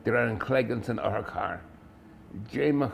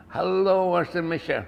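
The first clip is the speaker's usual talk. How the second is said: raised, higher calling hello; louder, pitched-up call for attention; 8 semitones higher, 5 dB louder, slower than usual. Acted. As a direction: call out to get someone's attention. Fallen narrator calls out for attention.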